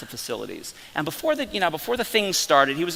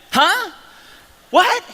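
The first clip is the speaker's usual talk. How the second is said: high, loud, startled "Huh? What?"; stunned staff reaction; 8 dB louder than usual. Retold or imitated. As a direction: blurt it out in shock like a caught-off-guard aide.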